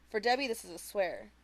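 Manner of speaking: ordinary delivery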